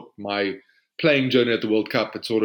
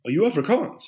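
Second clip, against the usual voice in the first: higher, surprised teammate voice; teammate's sudden question, higher; imitating a teammate; 8 semitones higher than usual. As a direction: ask it like a puzzled teammate. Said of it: "he goes".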